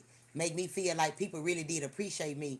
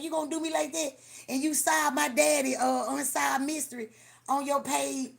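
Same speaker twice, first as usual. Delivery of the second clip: higher, louder voice, put-upon and whiny; mock hurt girl; 7 semitones higher, 5 dB louder than usual. Fan complains T forgot her, in a high mock-hurt voice. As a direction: play the wounded fan.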